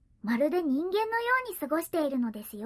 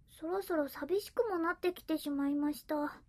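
usual speaking voice

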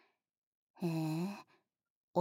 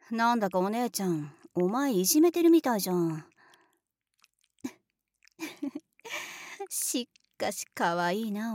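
ordinary talk, nothing marked

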